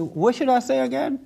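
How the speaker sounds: put-on high, puzzled retelling of the boy's question; puzzled asking. The boy, unsure what to tell his father, asks in a high puzzled voice. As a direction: voice jumps up as if lost for the words.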